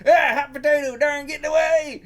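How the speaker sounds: high, loud cartoon shout; character yell. Beggar yelling as the thing escapes, high shout.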